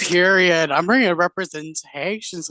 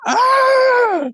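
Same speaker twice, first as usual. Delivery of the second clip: high, loud happy scream; excited squeal; 7 dB louder than usual. Real, not acted. Winner of the finale squealing with real joy. Winner reacting to her win.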